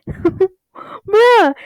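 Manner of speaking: high, loud laughing "Bruh!"; laughing outburst. Real, not acted. Reader breaks off at the embarrassing yell, laughing outburst. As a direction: burst out laughing, voice jumping up. Laughs at the story she reads.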